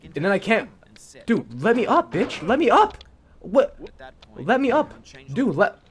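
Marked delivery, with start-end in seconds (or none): 1.2-5.8 high, loud yells at the screen; real rage at a stuck character; frustrated yelling. Real, not acted. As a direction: demand it, fed up and shouting. Raging at stuck game character.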